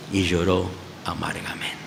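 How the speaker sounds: whispered grief; hushed whisper. A narrator's reading. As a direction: let the sorrow drop to a whisper. Peter wept bitterly, narrated.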